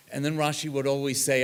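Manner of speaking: normal talking voice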